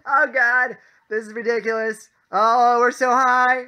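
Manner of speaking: high, loud panicked wail, imitating roommate; mock freak-out. Roommate freaking out as the ride climbs, mock panic. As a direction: wail in exaggerated terror, stretching the last words. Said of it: "why is he freaking out so much".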